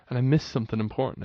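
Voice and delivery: usual speaking voice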